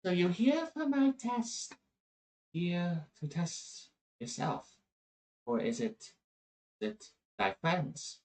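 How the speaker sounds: high, soft creature voice; small, gentle pup asking questions; little pup. Acted. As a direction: speak softly and high, like a mystical pup. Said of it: "you hear the little pup speak like this".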